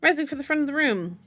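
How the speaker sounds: usual speaking voice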